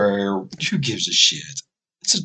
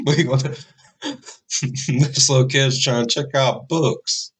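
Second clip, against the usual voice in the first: ordinary delivery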